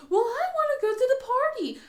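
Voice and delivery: put-on high, loud, far-off child whine; insistent girl. Jana insisting to mom, a loud high whine. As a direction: insist like a stubborn little girl.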